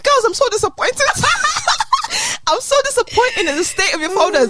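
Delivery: hoge, overdreven teleurgestelde lerares; mock disappointed teacher. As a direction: speel overdreven teleurstelling, hoog. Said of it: "she's like".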